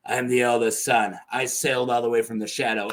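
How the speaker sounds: lightly voiced low, grand claimant; low character voice. Euron starts talking to the crowd, lightly acted low voice. Acting Euron's solemn speech.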